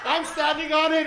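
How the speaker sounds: ordinary talk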